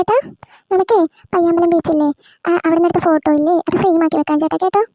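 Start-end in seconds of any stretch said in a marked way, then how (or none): none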